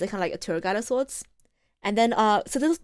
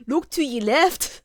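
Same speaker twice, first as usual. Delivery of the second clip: slow, drawn-out mock Irish; pretend Irish accent; 4 semitones higher, 4 dB louder, slower than usual. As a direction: stretch out the tour line in fake Irish. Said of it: "pretend this is an Irish accent".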